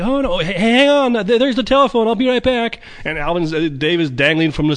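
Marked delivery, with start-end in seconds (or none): 0.0-2.8 high, chirpy cartoon chipmunk voice; Alvin voice. Alvin brushing off hanging Dave, high chipmunk voice. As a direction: play the carefree Alvin, squeaky and breezy. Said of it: "He's like".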